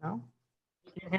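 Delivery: inside a barrel. Real, not acted. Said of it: "you sound like you're in a barrel".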